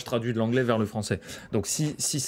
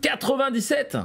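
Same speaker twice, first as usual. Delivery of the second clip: sudden high excited yell; excited shout; 4 dB louder, slower than usual. Real, not acted. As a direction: blurt the number out high and loud. Random number shouted during call.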